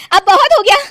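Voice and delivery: high, loud furious declaration; angry outburst. Acted. Kalpana to the family, furious shout. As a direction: shout it out, fed up for good. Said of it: "कल्पना को बहुत गुस्सा आ जाता है".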